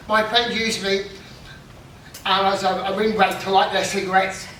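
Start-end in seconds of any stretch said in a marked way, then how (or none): none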